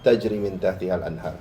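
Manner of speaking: low, rapid, measured Arabic recitation. Acted. Khatib opening a khutbah with verse and prayer, low quick recitation.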